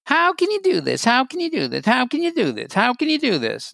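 high, goofy repeated taunt; be silly to take its power away; silly mockery. Shown as a demonstration. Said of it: "you can be silly".

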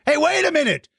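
high-pitched playful outrage; mock indignation. Host spotting himself in a kid's drawing, mock indignant.

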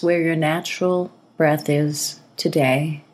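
calm breathing-exercise guidance, spaciously paced; guided meditation. Acted. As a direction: ease the listener into relaxing, phrase by phrase.

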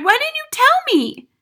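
very high, loud reproach; crying out. A narrator's reading. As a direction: demand it in distress. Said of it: "Matilda cried".

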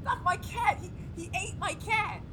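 high, panicky cry for help; distraught outburst. Acted. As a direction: blurt out the horror, voice climbing. Assistant reports his cat eaten.

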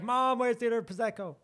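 put-on high whiny kid calling mum. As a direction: pipe up like a child. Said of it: "Be like".

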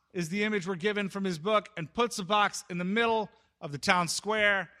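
usual speaking voice

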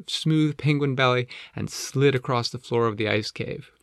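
plain reading voice

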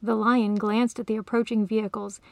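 ordinary delivery, nothing marked

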